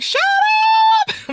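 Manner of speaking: mock excited shout. Mother after Oprah call, mock shout. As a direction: yell it out in disbelief. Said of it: "I started shouting".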